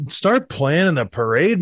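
normal talking voice

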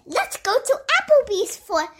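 high, cheerful bee voice; high bumblebee voice. Acted. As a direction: chirp it like a little bee. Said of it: "the Bumblebee said".

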